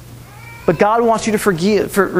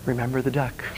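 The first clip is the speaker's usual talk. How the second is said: soft, much quieter, menacing; sly whispered threat; 6 semitones lower, 10 dB quieter than usual. Retold or imitated. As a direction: hush it like a secret threat.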